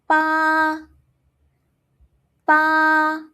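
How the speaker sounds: long, loud, high "pa" without blowing; unaspirated first tone. Shown as a demonstration. Teacher models pinyin ba, "pa" unblown, high and level. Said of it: "It's pronounced as".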